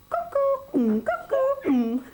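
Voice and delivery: very high, loud cuckoo; cuckoo call. A narrator's reading. Living room clock calling, very high cuckoo. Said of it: "living room clock".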